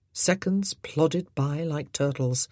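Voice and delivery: usual speaking voice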